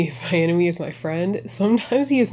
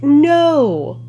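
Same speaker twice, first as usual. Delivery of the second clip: emphatic no; 6 semitones higher, 7 dB louder than usual. Real, not acted. Retorting to a mocked search result.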